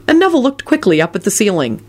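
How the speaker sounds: usual speaking voice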